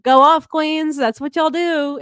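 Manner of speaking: imitating hype teammates, high and loud; hyped-up cheering. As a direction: cheer them on like the bench behind. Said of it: "getting so hype in the background".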